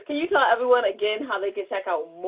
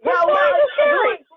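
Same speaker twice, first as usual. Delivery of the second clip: laughing; 5 dB louder, slower than usual. Real, not acted. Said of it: "laughing reaction".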